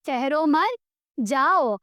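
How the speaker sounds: ठहरो मत joined and rising, pause, then जाओ; group ठहरो with मत, break before जाओ; pause after मत. Shown as a demonstration. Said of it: "इसी स्टेटमेंट को मैंने लिखा". (ठहरो मत एक साथ, रुककर जाओ)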